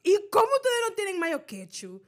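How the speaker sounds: high, loud, fast outraged question; indignant mock disbelief. Asking the cashier why they have no mayo quechu, outraged. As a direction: demand it in disbelief. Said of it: "And I'm like".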